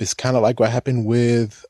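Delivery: ordinary talk, nothing marked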